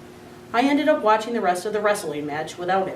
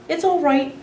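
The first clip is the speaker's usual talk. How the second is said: squeaked weakly; 8 semitones higher, 4 dB louder than usual. A narrator's reading. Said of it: "I squeaked weakly".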